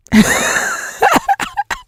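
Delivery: a sudden high burst of laughter. Real, not acted. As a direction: break into a high-pitched laugh. Laughs at his reply.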